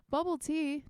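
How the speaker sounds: gasping, high and delighted; light up at the surprise gift. Real, not acted. Gasps at a bubble tea gift.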